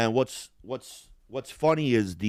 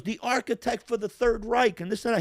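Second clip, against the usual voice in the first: high explaining soldier; 10 semitones higher than usual. Retold or imitated. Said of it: "He goes".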